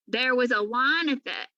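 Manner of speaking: ordinary delivery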